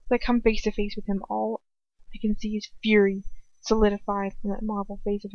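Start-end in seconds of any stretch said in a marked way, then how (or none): none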